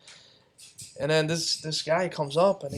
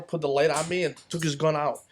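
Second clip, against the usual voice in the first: ordinary talk, nothing marked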